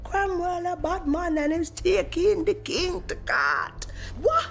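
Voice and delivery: high-pitched, indignant mock protest; hammed-up outrage. Rioters hear the king is going to court, indignant. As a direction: play a scandalised crowd, voice shooting up.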